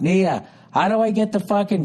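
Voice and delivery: high yell up the stairs to his wife; yelling upstairs. Retold or imitated. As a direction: call up to Nia, pitch way up. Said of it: "I yell upstairs".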